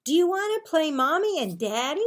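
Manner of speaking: put-on high, loud four-year-old voice; small sister begging her big brother; little sister. A narrator's reading. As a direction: play an eager little girl. Little sister Lindsay asks.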